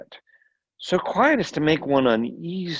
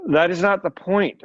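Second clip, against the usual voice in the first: cold; 6 semitones higher, 5 dB louder than usual. A narrator's reading. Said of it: "she said coldly".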